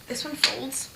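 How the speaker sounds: hushed whisper so he won't hear. Real, not acted. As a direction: keep it a secret whisper. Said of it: "I have to whisper because otherwise he will hear me".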